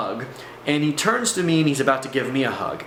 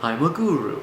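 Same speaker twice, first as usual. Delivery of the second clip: guru talk; 3 semitones higher than usual. Shown as a demonstration. Storyteller showing how the guru talks, mock guru voice. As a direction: put on a guru's spaced-out welcome. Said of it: "what I call the guru talk".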